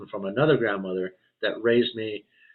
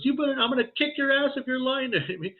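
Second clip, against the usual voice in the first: tearful threat; faster than usual. Retold or imitated. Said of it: "she started crying".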